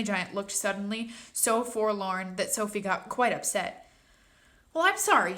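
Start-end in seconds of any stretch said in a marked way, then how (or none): 4.7-5.4 put-on young girl voice. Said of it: "she said".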